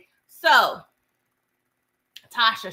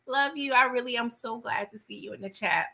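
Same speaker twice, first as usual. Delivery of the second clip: normal talking voice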